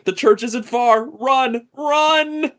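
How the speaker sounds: hammed-up urgent yell; mock-panicked shout; frantic shouting. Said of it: "again, Anna's like".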